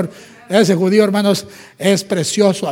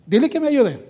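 higher insistent plea; mock pleading demand; 5 semitones higher than usual. Marta insisting Jesus tell María to help, higher and whiny. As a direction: whine for help. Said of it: "Marta pleading demand".

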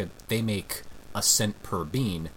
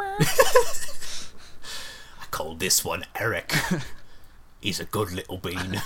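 Cockney bean man, fond and odd; fake Cockney accent; 3 semitones lower than usual. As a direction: play a strange Cockney bean obsessive. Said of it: "a strange Cockney man that is obsessed with beans".

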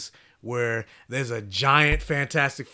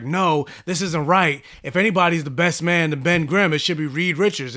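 indignant outburst; 4 semitones higher, 7 dB louder than usual. Retold or imitated. Ben protesting to Johnny about best man, raised voice. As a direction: push back hard, voice up and louder. Ben objects angrily at wedding.

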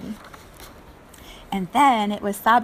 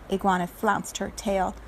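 plain reading voice

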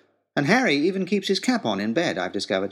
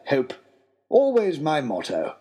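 a higher, lightly voiced pompous father; father's voice; 4 semitones higher, slower than usual. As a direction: play the father proudly quoting his motto. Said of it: "is what he said".

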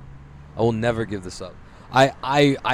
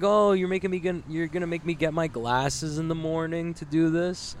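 high, put-on whiny complaint; mock complaining doctor; 6 semitones higher than usual. Patient mimicking his doctor's grumbling about glasses, high mock complaint.

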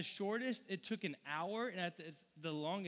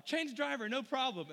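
higher, louder grinning driver; smiling driver, pitched up; bigger smile; 7 semitones higher, 5 dB louder than usual. Retold or imitated. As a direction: beam through the words. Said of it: "even a bigger smile".

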